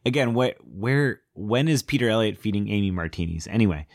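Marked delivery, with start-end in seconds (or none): none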